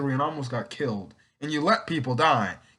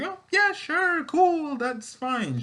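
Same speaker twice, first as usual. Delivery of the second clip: mock awkward, very high squeaky agreement; awkward reply; slower than usual. Courtney agreeing awkwardly to Cameron's date, high mock-awkward voice. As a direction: squeak out a forced, awkward yes. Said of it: "she says it in the most awkward way".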